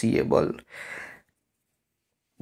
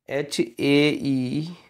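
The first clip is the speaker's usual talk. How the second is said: slow spelling out; much slower than usual. Shown as a demonstration. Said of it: "यहाँ H A E".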